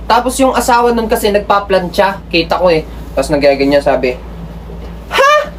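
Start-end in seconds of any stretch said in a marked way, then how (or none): 5.1-5.5 high, startled defensive squeak; shrill up as if caught off guard; defensive wife. Retold or imitated. Wife denying her husband's accusation, high defensive voice. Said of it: "parang kita mo sa kanya defensive".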